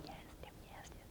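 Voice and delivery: soft breathy whisper of approval; whispered urging. Retold or imitated. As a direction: egg her on under your breath. Mrs Minch urging quietly in the background.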